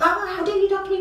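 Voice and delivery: high, loud, indignant outburst; mock angry person. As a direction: play the offended hothead. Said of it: "as an angry person".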